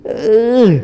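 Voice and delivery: short pained groan of a sick man; imitated groan. Sick Imam groaning in pain, imitated moan. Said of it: "kayak gitu".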